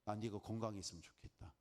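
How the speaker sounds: hint of a gentle whisper; soft voice. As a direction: say it softly as the Lord. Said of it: "주님 말씀하실 거 아니에요".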